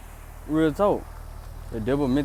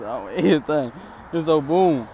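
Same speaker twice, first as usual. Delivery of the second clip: usual speaking voice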